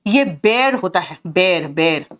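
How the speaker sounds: "बेर" said like "bare", one short vowel; "बेर" spoken slowly, like English bare; correct pronunciation. Shown as a demonstration. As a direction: stretch out "बेर, बेर" clearly for the class. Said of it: "बेर करके होता है pronunciation इसका".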